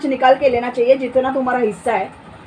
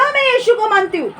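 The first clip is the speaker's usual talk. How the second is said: "मैं यीशु को मानती हूँ" said higher and proud; confident public confession of faith; bold declaration; 7 semitones higher than usual. Shown as a demonstration. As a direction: declare it boldly, pitch raised. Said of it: "तो बोलो".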